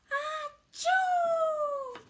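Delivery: long, loud, high comic sneeze; huge sneeze. Acted. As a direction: let out an enormous sneeze. Gerald sneezes.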